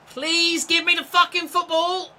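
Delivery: screams at his own teammate, high and loud; furious shout. Real, not acted. Gamer yelling at his teammate for the ball, furious shout. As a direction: yell it, fed up and demanding.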